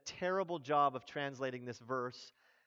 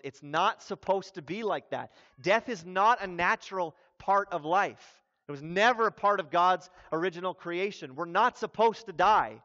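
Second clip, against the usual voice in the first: louder, emphatic protest in Jesus's voice; retold anger; 3 semitones higher, 7 dB louder than usual. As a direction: preach it as an indignant objection, pushing the volume. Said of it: "and he's angry".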